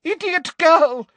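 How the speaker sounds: high, exasperated shout, loud at first; angry shout. A narrator's reading. Professor berating pupil for useless answer, shouting. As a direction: scold her at a shout. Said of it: "shouted Umbridge".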